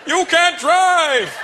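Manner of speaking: high, loud, very drawn-out yell; mock road-rage shout, stretched; angry driver yell. Driver yelling at dad, high drawn-out mock road rage. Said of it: "Some guy cut off my dad".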